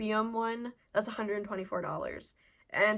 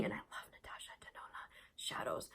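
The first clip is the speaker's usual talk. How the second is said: breathy whispered confession of love; adoring whisper; 14 dB quieter than usual. Real, not acted. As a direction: whisper it like you adore them. Hushed gushing over favourite eyeshadows.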